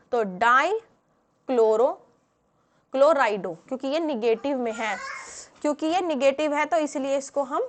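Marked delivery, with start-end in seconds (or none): 0.2-3.5 slow, loud, stretched-out syllables; dictating. Real, not acted. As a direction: dictate the chemical name piece by piece. Builds chemical name while writing it.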